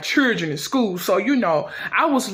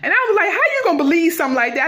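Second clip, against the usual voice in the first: high, fast outraged protest; high-pitched rushed disbelief; indignant protest; 8 semitones higher, faster than usual. Acted. [name] protests body odor.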